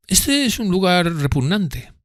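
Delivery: high, slow, affected disdain; voicing Drumley with slow, high disdain; disdainful Drumley. A narrator's reading. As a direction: sniff at the place.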